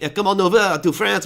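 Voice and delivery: mock French host's accent, inviting people over. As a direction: put on a playful French accent. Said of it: "do it in a French accent".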